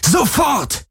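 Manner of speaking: high, raging commands; furious command. Acted. Galen commands the shadow weapon in rage.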